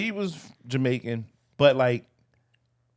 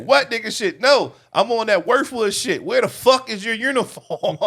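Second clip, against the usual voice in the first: raised, louder retelling of a heated comeback; replaying the comeback louder and higher, half laughing; retold heated comeback; 8 semitones higher, 6 dB louder than usual. Coworker retelling how he told off a driver, louder and higher.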